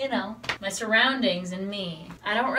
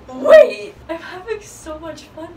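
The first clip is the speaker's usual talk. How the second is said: sudden loud laughing cry of wait; laughing shout; 7 semitones higher, 11 dB louder than usual. Real, not acted. Cracking up alone on camera, laughing out loud. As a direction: blurt it out mid-laugh.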